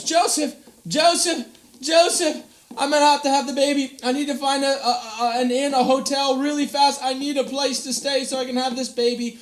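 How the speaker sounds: high, fast and urgent; urgent Mary. Acted. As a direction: call for him in a panic. Mary in labour calling Joseph.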